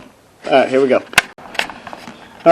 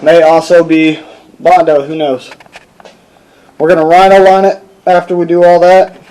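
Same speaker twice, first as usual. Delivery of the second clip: usual speaking voice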